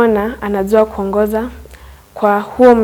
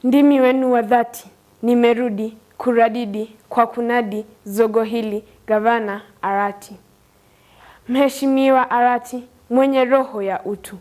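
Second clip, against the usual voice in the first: measured, rhythmic recital of a praise poem; poem recitation; 5 dB quieter than usual. Acted. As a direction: declaim each verse and land the refrain. Student recites his poem.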